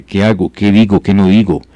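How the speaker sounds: usual speaking voice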